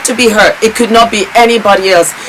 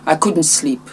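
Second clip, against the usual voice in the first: low, very soft, halting with long pauses; quiet, heartbroken confession; sad and tearful; 5 semitones lower, 13 dB quieter than usual. Real, not acted. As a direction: speak through real grief, barely holding on. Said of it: "I feel sad".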